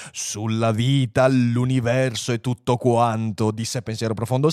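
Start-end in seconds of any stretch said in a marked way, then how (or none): 0.1-3.5 slow, portentous announcement; solemn computer. Acted. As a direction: draw out the build-up ponderously. Computer solemnly builds suspense. (annuncio lento e solenne)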